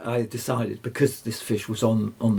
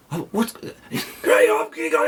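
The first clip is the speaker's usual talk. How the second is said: high, breathless, voiceless babble; incoherent excitement; 11 semitones higher, 4 dB louder, slower than usual. Retold or imitated. Rod on the phone box call, incoherent with high excitement. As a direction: gush it out too excited to form words. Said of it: "he was incoherent".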